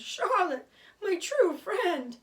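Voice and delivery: loud, high, drawn-out grieving cry; sobbing out his friend's name; anguished wail. A narrator's reading. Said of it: "he moaned".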